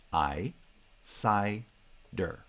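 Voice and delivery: "I, si, der" said as separate beats with a pause between each; split into syllables. Shown as a demonstration. Lecturer spelling out cider's syllables for students, slow and separated. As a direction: break "I, si, der" into three spaced syllables. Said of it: "The word cider".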